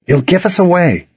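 high, anxious-to-hide urging. A narrator's reading. Boy to dog refusing to hide, urging. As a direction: press the dog to move before you're spotted. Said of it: "I urged".

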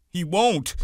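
Lenny frantically denying George will leave; frantic cry. A narrator's reading. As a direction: cry out in panic. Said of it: "Lenny cried frantically".

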